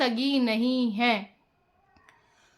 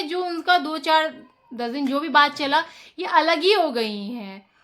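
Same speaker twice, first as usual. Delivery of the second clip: ordinary talk, nothing marked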